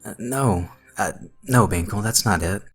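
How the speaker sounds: anxious, halting protest; nervous stammer. Acted. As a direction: stammer out a timid refusal. Frightened boy stammers to monster.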